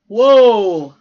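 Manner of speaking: shouted exclamation; loud shout. A narrator's reading. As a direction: blurt it out loud. Boys react to huge girl.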